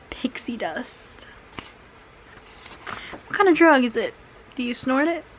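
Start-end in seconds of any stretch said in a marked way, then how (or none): 0.1-1.3 breathy whisper to herself. Real, not acted. Reading vlogger whispering the drug's name to herself. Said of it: "mulling the drug name".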